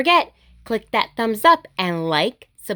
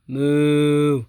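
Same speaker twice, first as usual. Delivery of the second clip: low drawn-out moo; cow moo; 8 semitones lower than usual. Pretend. Reader voicing the cows for kids, a low moo. Animal sounds in picture book.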